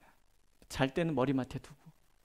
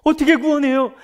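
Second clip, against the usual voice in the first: much higher and louder cry; rhetorical shout; 10 semitones higher, 15 dB louder than usual. Acted. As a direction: fling the question out to the hall. (높고 크게 외치는 질문)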